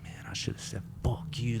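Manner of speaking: whispered, unvoiced inner grumble; whispered thought. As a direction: hiss it under your breath as a secret thought. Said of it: "I was like".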